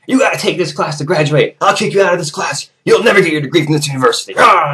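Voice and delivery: high, loud imitated rant; yelling coach. Coach threatening to kick student out, yelling. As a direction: rage and threaten, ending in a wordless yell. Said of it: "he starts yelling at me".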